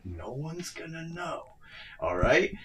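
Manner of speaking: hushed, secretive whisper; conspiratorial whisper. Acted. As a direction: whisper it like a sneaky plan. Acting sneaky sound rigger.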